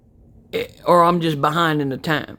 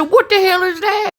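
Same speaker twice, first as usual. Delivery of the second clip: high-pitched, acted-out bafflement; incredulous; 13 semitones higher than usual. Dad retelling first sight of the Alexa, high incredulous question. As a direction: voice leaps up in disbelief.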